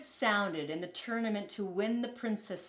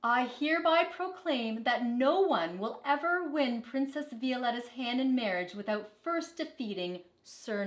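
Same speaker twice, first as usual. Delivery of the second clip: royal proclamation; 4 semitones higher, 4 dB louder than usual. Acted. Princess makes royal proclamation.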